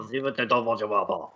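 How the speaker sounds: lightly hammed fake French phrases. Friend bluffing French for imagined French listeners, lightly hammed. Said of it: "you spoke French".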